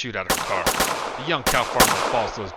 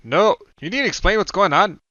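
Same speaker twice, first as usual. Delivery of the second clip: much higher, louder demand; yell; 10 semitones higher, 11 dB louder than usual. A narrator's reading. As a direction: shout for answers. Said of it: "yells the older man".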